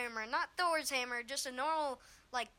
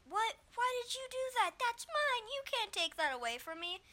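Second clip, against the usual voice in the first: put-on whiny kid; 10 semitones higher than usual. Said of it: "he's just like".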